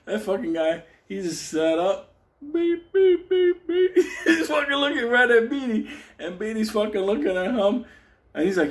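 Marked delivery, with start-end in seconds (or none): 2.4-3.9 high monotone "beep" on a steady pulse; alarm clock beeping. Retold or imitated. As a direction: mimic an alarm clock, one even pitch. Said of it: "like a fucking alarm clock".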